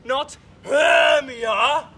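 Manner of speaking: slow, loud, drawn-out cry; impassioned cry. Acted. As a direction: wail the name passionately. Lysander declares new love.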